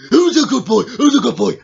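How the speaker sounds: loud, playful pet talk; excited dog petting. Acted. Tickling Tiggy behind the ears, loud playful fussing. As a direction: gush boisterously over the dog.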